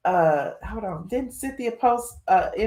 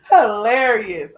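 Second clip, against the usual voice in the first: slow, loud word through real laughter; cracking up mid-word; laughing; 2 semitones higher, 8 dB louder, slower than usual. Real, not acted. Laughing at gossip.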